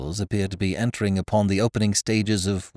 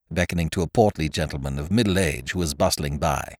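ordinary delivery, nothing marked

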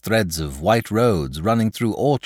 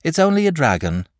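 put-on high boy's voice, unbothered and mildly drowsy; sleepy reassurance; 10 semitones higher, 5 dB louder, faster than usual. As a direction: play the danger down as a sleepy boy. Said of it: "said sleepily".

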